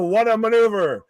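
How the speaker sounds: imitated commentator's big call; Vince. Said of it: "Vince was".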